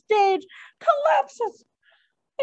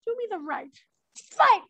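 mock Mercutio challenge; 7 semitones higher than usual. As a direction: goad him into the fight, ending in a yell. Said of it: "And Mercutio says".

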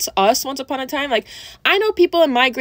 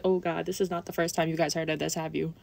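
quiet; 7 semitones lower, 11 dB quieter than usual. Retold or imitated. Weary aside to staff.

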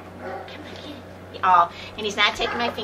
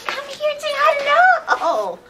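much higher, slow sing-song; sing-song dog talk; 4 dB louder, slower than usual. Real, not acted. Coaxing the dog to come say hello, high sing-song. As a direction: coax him over in a high lilting call.

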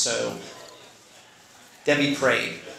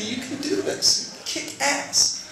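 wife's encouragement; 11 semitones higher, 3 dB quieter, much slower than usual. Retold or imitated. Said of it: "she'd hold me and she'd say".

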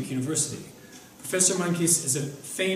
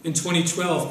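ordinary delivery

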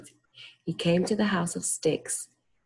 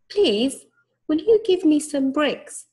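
high squeaky pig voice; little pig voice; 10 semitones higher, 6 dB louder than usual. A narrator's reading. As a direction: squeal the request like a piglet. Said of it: "asked the third little pig".

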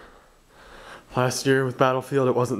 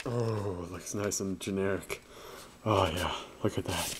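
much softer, low murmur; quiet murmur; 7 semitones lower, 11 dB quieter than usual. Real, not acted. Unboxer murmuring quietly about the case. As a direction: say it under your breath. Very soft commentary.

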